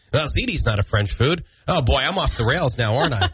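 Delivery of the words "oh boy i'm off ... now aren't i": normal talking voice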